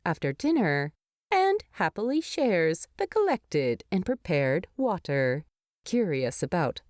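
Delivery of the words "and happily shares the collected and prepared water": ordinary delivery, nothing marked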